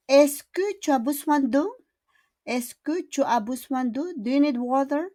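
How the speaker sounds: "d'eau" lifted up high at the end; rising question tune. Shown as a demonstration. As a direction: rise on the last word. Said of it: "we need to do the intonation". (pataas ang boses sa "d'eau")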